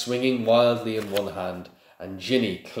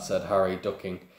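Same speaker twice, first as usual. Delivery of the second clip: usual speaking voice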